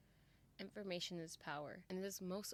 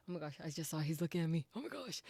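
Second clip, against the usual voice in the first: fast, much louder squeal of delight; giddy excitement; 8 dB louder, faster than usual. Real, not acted. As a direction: gush over the character. Gamer fangirls at character.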